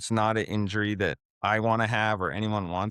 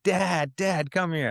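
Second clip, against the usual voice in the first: put-on higher, eager little kid calling out; kids calling dad; 7 semitones higher, slower than usual. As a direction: call for dad like an excited child. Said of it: "All I want is my kids to be like".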